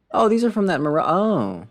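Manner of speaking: sudden high, loud yelp of recognition; surprised. Real, not acted. Streamer spotting new furniture in the shop, surprised yelp. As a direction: catch sight of it and jump up in pitch.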